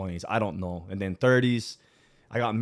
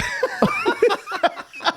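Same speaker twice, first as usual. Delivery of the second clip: a much higher burst of real laughter; big laugh; 6 dB louder than usual. Real, not acted. Guest laughing at the host who says he's working on his shape, a loud high laugh.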